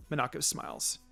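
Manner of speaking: ordinary delivery, nothing marked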